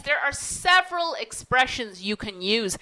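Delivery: ordinary talk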